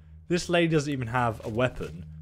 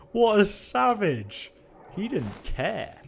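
amused awe; 9 semitones higher, much slower than usual. Real, not acted.

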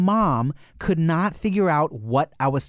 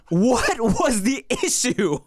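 high, slow, drawn-out incredulity; exasperated demand; 8 semitones higher, much slower than usual. Retold or imitated. Son challenging his parents over one letter, exasperated. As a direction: stretch each word high in disbelief.

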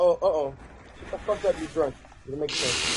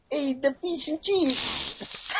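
high, drawn-out fake British; slow, pitched-up mock British; bad British impersonation; 7 semitones higher, much slower than usual. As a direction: mimic a friend's terrible British accent. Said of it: "British impersonation. He's like".

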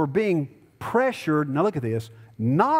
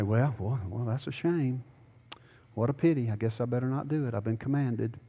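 mock resignation; 5 semitones lower, 6 dB quieter than usual.

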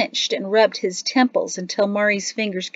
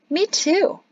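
high, eager agreement; quick-to-join child's agreement; chiming in; 12 semitones higher, 4 dB louder than usual. A narrator's reading. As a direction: chime in, pitched well up. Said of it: "Jatsi chimed in".

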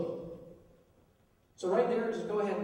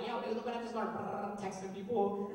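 casual dude voice; 3 semitones lower, 8 dB quieter than usual. Retold or imitated. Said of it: "we're like".

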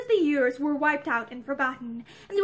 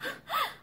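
soft, high stifled gasp; small, high smothered gasp; stifled cry; 7 dB quieter than usual. A narrator's reading. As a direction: catch a startled cry in the throat. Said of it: "Pansy gave a stifled cry".

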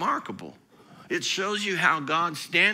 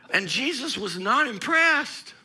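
normal talking voice

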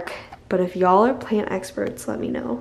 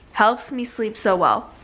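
usual speaking voice

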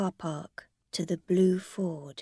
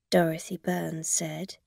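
usual speaking voice

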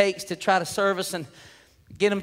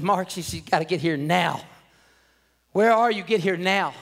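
ordinary delivery

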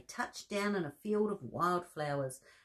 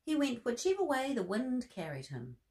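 plain reading voice

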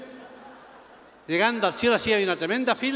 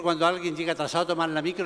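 ordinary talk, nothing marked

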